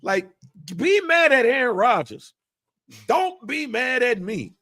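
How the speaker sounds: high, exasperated pleading; indignant retold protest. Son protests to family over Aaron Rodgers, high indignant retelling.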